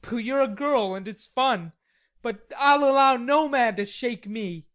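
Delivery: scoff, then declare it hotly; proud defiance. Acted. Laurie boasting no man shall shake him, loud scorn.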